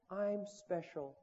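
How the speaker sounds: slow, soft mock 'I'm special'; whisper-like mock sincerity; soft self-affirmation. Women on Oprah affirming themselves, soft and slow. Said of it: "they're saying things like".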